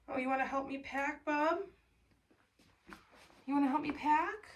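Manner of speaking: high, sing-song voice for the cat; pet talk. Real, not acted. Talking up high to her cat bub.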